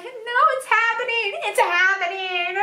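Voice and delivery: cringing viewer dreading the fork-eating scene, mock dread. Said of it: "I'm like".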